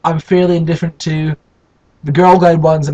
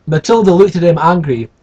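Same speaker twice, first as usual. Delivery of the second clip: ordinary delivery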